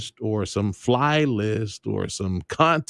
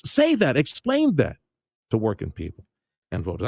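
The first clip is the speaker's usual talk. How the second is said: ordinary delivery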